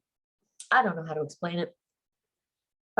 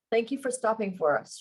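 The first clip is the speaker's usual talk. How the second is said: breathy; 3 semitones higher than usual. Acted. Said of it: "she breathes".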